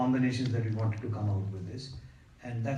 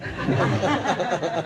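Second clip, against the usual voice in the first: real laugh; 6 semitones higher, 6 dB louder than usual. Real, not acted. Laughing after the teasing remark about looking young. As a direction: burst of real laughter at the tease.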